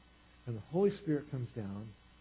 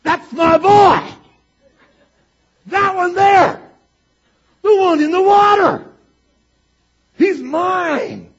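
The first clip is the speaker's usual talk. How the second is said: very high, full-volume holler, drawn out; God's proud shout; 23 dB louder, much slower than usual. Acted. God pointing out his son in the water, ecstatic shout. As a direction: shout it like a thrilled parent claiming a child. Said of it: "and God goes".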